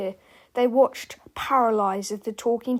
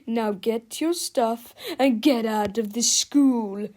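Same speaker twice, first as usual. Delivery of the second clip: stern principal; 3 dB louder, slower than usual. A narrator's reading. Principal orders boys out.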